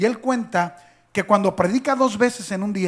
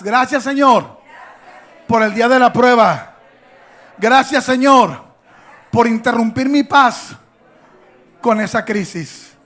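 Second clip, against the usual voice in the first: "Gracias, Señor" shouted louder, reaching the back rows; louder so the back hears; 2 semitones higher, 10 dB louder than usual. Shown as a demonstration. Leading the church to repeat, loud for the back rows. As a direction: call it out to the very back. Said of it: "Hasta atrás".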